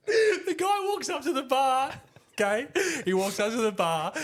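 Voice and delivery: real laughter creeping into high voice; high-pitched, barely contained giggles; laughing. Real, not acted. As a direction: start the punchline while cracking up. Cracking up at own story.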